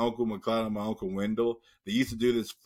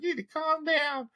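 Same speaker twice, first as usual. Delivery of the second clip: much higher put-on woman's voice; a high mock aunt calming him; imitated Sonya. Aunt Sonya telling the kid to calm down, high mock voice.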